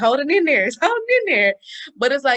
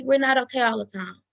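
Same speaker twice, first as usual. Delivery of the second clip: ordinary delivery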